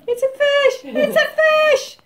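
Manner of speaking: high, loud delighted shout; excited shout. Real, not acted. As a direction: shriek it out gleefully. Excited outburst about mosaic shape.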